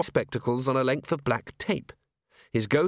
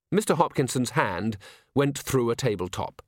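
ordinary delivery

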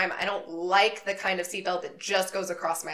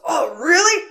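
much higher and louder, leering delight; imitating the driver; 10 semitones higher, 11 dB louder than usual. Driver hearing passenger is a massage therapist, mock eager. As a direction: perk up far too eagerly. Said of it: "And he goes".

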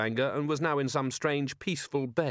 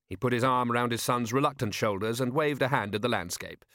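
usual speaking voice

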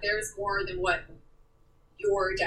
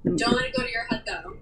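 playful teasing; 11 semitones higher, faster than usual. Real, not acted. Teasing mom after the host's praise.

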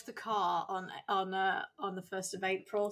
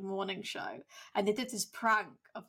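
ordinary talk, nothing marked

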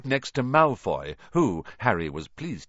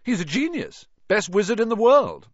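proud schoolboy; 12 semitones higher, 7 dB louder than usual. A narrator's reading. Percy praising the headmaster.

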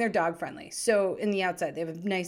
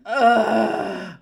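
sulky groan; 10 dB louder than usual. Retold or imitated. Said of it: "The daughter looks up at us and she's like".

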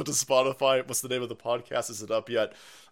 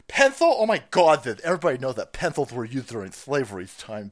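lisping mock outrage; 4 dB louder than usual. Host feigning outrage over a pencil, lisping shocked voice. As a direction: act offended with a put-on lisp.

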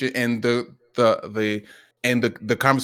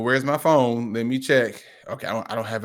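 usual speaking voice

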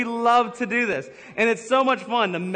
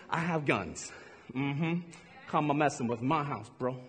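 lower, softer menacing boast; mock tough guy; 6 semitones lower, 7 dB quieter than usual. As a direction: play the hard protector, low. Tough man of house pose.

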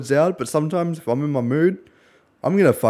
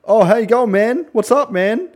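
mock friendly enemy; 10 semitones higher, 6 dB louder, slower than usual. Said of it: "the guy was like".

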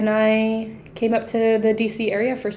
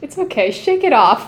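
ordinary talk

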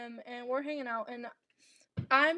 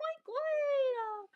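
much higher, falling on what; high surprised what; 13 semitones higher than usual. Shown as a demonstration. Talking herself out of a crush, squeaky what.

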